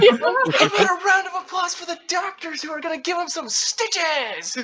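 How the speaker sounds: very high, hammed-up announcer shout; hype man. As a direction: spin it upbeat like an over-the-top hype man. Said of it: "still in that hype man voice".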